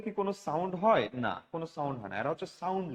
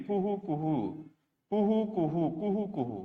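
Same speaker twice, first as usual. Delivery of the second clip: soft cuckoo call; 4 dB quieter than usual. Shown as a demonstration. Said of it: "কোকিলের আওয়াজ কি?".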